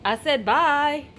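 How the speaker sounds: high sing-song voice for a pet; talking to a dog. Acted. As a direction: coo at the dog like a baby.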